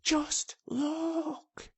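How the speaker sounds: breath-only urgent whisper; excited whisper. A narrator's reading. Colin pointing to the wall, excited whisper. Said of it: "he whispered excitedly".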